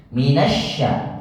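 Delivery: "sya" with a long spread hiss; spread sh sound. Shown as a demonstration. Teacher showing how to spread the sya sound. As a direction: stretch the "sya" into a drawn hiss. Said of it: "sya disebarin".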